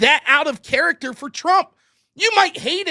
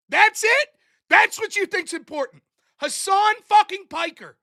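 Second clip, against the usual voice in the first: higher and louder exasperated yelling at the chatter; angry rant; 6 semitones higher, 3 dB louder than usual. Real, not acted. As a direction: tear into him, outraged and scornful. Ranting at a chatter who interrupted.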